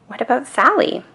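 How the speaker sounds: usual speaking voice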